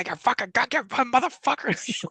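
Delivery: high hammy thug yell, slow finish; mock gangster shout. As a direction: ham up a thug. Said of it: "I'm surprised he didn't go".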